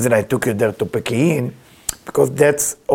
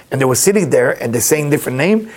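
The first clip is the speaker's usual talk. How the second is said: ordinary talk, nothing marked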